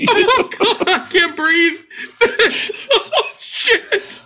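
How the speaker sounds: breathless high laughter through the words; can barely speak for laughing; cracking up at the dishwasher prank; laughing hard. Real, not acted. Helpless laughter at the story.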